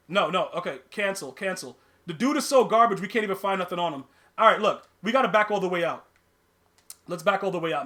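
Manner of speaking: usual speaking voice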